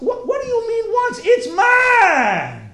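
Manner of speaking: high, loud mock-outraged wail; farmer's outraged shout. Farmer told he may harvest only once, outraged shout. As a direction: holler possessively, stretching the last word. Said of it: "Farmer says to you".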